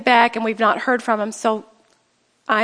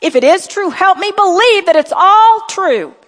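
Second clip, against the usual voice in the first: pleading modelled prayer; 6 semitones higher, 5 dB louder than usual. Shown as a demonstration. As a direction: beg for belief from the heart. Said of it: "Then pray".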